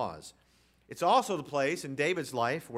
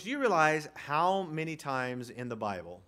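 ordinary delivery

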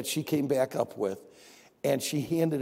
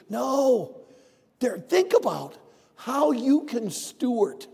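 loud, high, emphatic sermon; full preaching; 6 semitones higher, 4 dB louder than usual. Acted. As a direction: drive each point home to the church. Preaching stewardship to congregation.

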